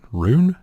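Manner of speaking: "Rune?" as one syllable, rising like a guess; ruin as rune. Shown as a demonstration. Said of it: "How else would you say ruin?".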